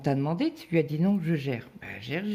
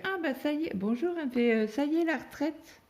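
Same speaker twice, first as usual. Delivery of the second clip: nurse's hello pitched much higher; quoting the nurse; 13 semitones higher than usual. Retold or imitated. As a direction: lift the nurse's words much higher to voice her. Quoting nurse greeting patient.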